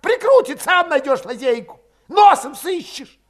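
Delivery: high, loud, exasperated retort; irritated outburst. A narrator's reading. Valet answering where they should flee, exasperated. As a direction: throw the questions back loudly. Same quarrel, Valet snapping back.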